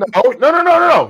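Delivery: loud, high-pitched burst of objection; emphatic protest. Real, not acted. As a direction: cut in loudly to take the floor back. Guest protests, takes the floor back.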